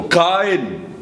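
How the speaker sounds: high, sharp cry of alarm; emphatic. Acted. Parent to child with fork at socket, emphatic cry. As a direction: yell it to stop someone at once. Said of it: "You're going to be emphatic".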